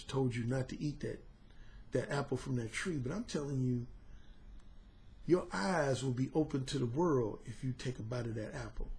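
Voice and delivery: low, soft persuasive murmur; hushed low sales pitch; smooth serpent. Retold or imitated. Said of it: "that serpent was smooth".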